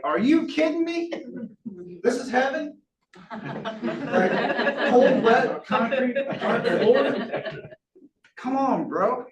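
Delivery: high comic outrage broken by laughs; mock disbelief. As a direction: act stunned and laughing that this is heaven. Mock disbelief at waking in dark.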